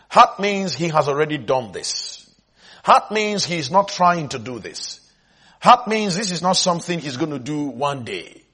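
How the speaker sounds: ordinary delivery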